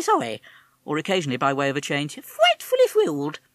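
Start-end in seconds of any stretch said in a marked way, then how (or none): 2.2-3.4 very high, plummy chinless-wonder voice; high affected toff parrot; affected posh parrot. Acted. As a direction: play a silly upper-class twit bird. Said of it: "the affected way he spoke, like a chinless wonder".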